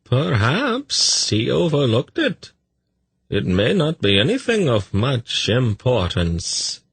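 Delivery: high, slow, swooping voice; smooth it over with courteous deference; placating messenger. A narrator's reading.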